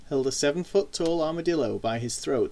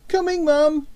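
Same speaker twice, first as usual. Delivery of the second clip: put-on high, loud boyish call; shouting back; 13 semitones higher, 6 dB louder than usual. A narrator's reading. Boy answering his mother as he runs downstairs, shouting. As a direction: call back to Mum from upstairs. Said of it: "he shouted as he ran".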